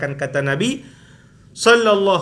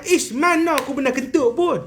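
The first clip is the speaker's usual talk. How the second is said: mock yell; 7 semitones higher than usual. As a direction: act out a sudden protesting cry. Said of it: "Tiba-tiba teriak". (olok-olok teriak, nada tinggi)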